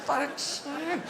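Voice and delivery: high, slow outraged disbelief; hammed-up dismayed realisation; exasperated discovery. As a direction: wail it as the dog who has been cheated. Dog discovers the rabbit is fake.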